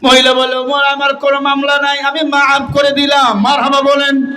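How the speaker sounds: high, sustained sermon chant; impassioned preaching. Acted. Preacher telling of the woman forgiving Umar, high impassioned chant. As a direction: declaim it high on one held note.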